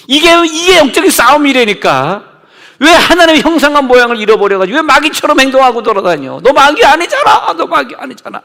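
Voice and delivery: very high, loud rebuking shout; fiery preaching. Acted. Pastor rebuking a sinner before the church, shouting high. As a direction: scold the devil-like sinner at the top of the voice.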